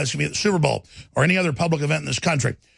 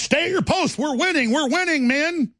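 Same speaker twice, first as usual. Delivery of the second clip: squeaky, much higher mock general; mock rally voice; 8 semitones higher than usual. Host mocking the president with a high, silly general voice. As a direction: cheer on the troops in a silly high voice. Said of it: "issuing another threat to the president".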